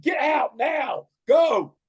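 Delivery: loud, high, slow shouted command; angry boss ordering them out; furious order. Retold or imitated. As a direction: bark them out the door. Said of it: "He said".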